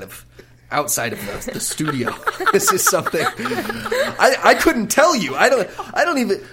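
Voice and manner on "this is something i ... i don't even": laughs through the words; giggly, high and flustered; laughing. Real, not acted. Laughing at the question.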